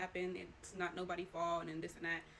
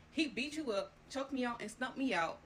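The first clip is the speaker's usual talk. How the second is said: louder, higher accusing voice; mock angry confrontation; 4 semitones higher, 5 dB louder than usual. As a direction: throw the accusations at her. Confronting mom, retold.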